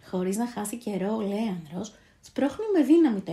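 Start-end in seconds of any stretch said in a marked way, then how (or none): none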